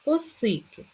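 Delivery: "Осики" with a high stressed middle syllable; "Осики", stressing the first и. Shown as a demonstration. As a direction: lift си in "Осики", then fall. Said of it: "наголос на першу і".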